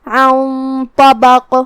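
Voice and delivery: عن طبق with a heavy nasal nun, slow; nasal nun of عن heavy before طبق; heavy ghunna. Shown as a demonstration. Teacher showing heavy ghunna, slow demo. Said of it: "غنہ کو بھی بھاری آواز میں ادا کریں گے".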